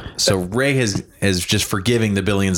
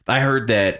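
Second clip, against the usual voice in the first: usual speaking voice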